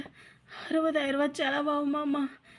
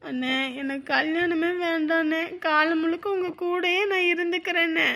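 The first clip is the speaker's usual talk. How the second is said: ordinary delivery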